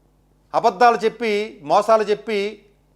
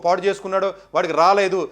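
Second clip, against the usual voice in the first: ordinary talk, nothing marked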